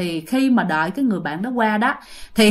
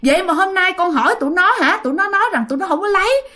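retold dismay, pitched up; higher, agitated mock outburst; startled exclamation; 8 semitones higher, faster than usual. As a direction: cry out in disbelief while imitating someone. Guard exclaims after being startled.

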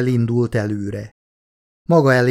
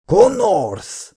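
bellowing; 8 semitones higher than usual. A narrator's reading. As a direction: roar his name down the tunnel. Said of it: "bömbölte Verheiden".

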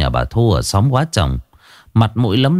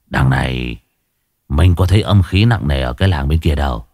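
lower, deferential servant voice; 6 semitones lower than usual. A narrator's reading. Hải Nghẹo speaking.

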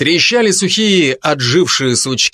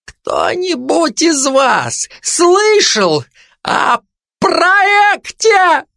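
sudden high, cracking cry; high breaking voice; much slower than usual. Acted. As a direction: stretch it out, rising until the voice breaks. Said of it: "спросил неожиданно высоким, срывающимся голосом". (высоким, срывающимся голосом)

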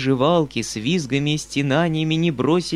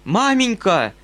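loud, high, pained cry of Mother; grieving cry; 8 semitones higher, 5 dB louder than usual. A narrator's reading. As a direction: cry out to her in grief. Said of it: "горестно вскричал дядя".

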